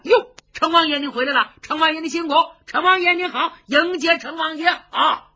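high, loud cries of welcome; eager hailing. Acted. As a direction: hail the lord as he rides in. Guards hail the returning lord.

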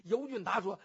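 usual speaking voice

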